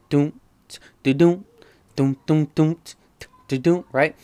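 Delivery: beatbox the song's classic sample; imitated beat sample. Shown as a demonstration. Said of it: "that has a classic sample".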